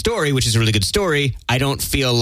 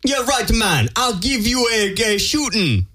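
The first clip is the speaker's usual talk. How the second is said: higher, hammed-up British gangster voice; a badly faked gangster drawl, pitched up; mock gangster accent; 7 semitones higher, slower than usual. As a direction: fake a tough British guy copying American slang. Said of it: "Is that how they do it?".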